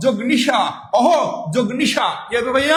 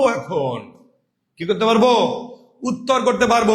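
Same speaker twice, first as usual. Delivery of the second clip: ordinary talk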